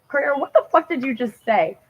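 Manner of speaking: lightly voiced stunned friend; incredulous retort. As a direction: ask it as though you cannot believe your ears. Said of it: "And I was like".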